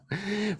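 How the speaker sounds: brief, quiet real laugh; soft chuckle. Real, not acted. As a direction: chuckle softly at your own example. Laughs after naming the Autobahn.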